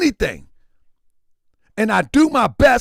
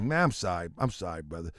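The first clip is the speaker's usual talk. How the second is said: quiet apology; 13 dB quieter, faster than usual. Real, not acted. Apologizing to a missing friend.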